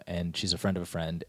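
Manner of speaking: ordinary talk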